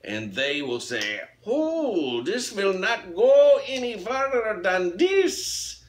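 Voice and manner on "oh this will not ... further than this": loud, high-pitched hammed-up German official; mock German accent. Host mocking UK customs police, mock German accent. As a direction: bellow it slowly in a joke German accent. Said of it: "they got a German accent".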